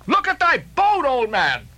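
high, urgent plea; Starbuck pleading. Acted. Starbuck urging the old man to look, urgent. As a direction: grab his attention in a panic. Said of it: "cried Starbuck".

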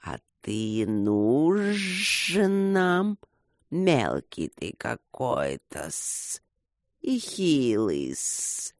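very slow, drawn-out hissing taunt; mocking serpents. A narrator's reading. Serpents mock puny Luke, drawn-out jeering hiss. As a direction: stretch the jeer, sliding in pitch. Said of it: "поддержали издевательство с другой стороны".

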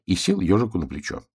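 ordinary delivery

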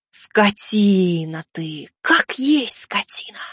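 slow, furious hissed curse; furious hissing. Acted. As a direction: hiss the insult slowly. Ormond curses after the fright.

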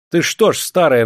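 high, loud scolding; snapping attack. A narrator's reading. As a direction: round on the old woman. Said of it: "накинулся он на бабку". (сердито накинуться на бабку)

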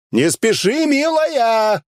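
high, drawn-out call; shouting after someone. A narrator's reading. Hero calling after Elsa riding away, shouting. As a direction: holler after a departing rider. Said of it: "закричал я ей вслед".

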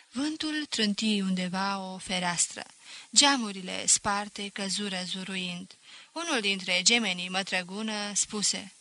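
usual speaking voice

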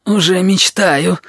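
laboured, straining groan of words; puffing with effort. A narrator's reading. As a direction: squeeze it out under a heavy weight. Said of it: "пропыхтел я".

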